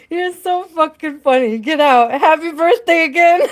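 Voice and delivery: high, loud words bursting into laughter; real cry-laughing. Real, not acted. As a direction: crack up in delighted disbelief. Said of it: "I'm cry laughing".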